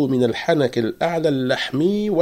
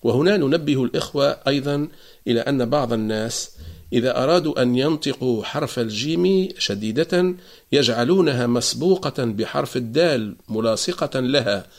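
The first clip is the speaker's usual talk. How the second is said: normal talking voice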